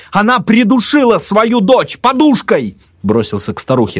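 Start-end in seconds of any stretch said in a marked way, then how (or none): 0.1-2.8 high, slow, emphatic shout; barked accusation. A narrator's reading. Narrator accuses the mother of murder, shouting. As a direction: hammer the accusation out loudly. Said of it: "рявкнул я".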